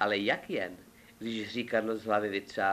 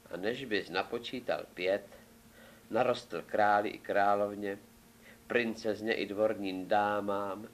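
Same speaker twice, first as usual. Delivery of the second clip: ordinary delivery, nothing marked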